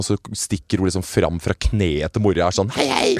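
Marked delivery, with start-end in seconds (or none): 2.8-3.1 high little hello; small child. Retold or imitated. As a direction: pipe up like a shy toddler. Etterligner lite barn som hilser. (lys barnestemme som hilser)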